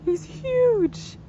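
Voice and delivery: high, loud gasp of amazement; real astonishment. Real, not acted. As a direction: blurt it out, stunned by the giant gator. Sees giant alligator beside the boat.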